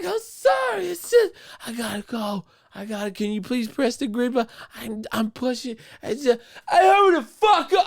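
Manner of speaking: very high frantic voice; flustered mimicry. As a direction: stammer apologies in a panic, high. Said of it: "And I'm like".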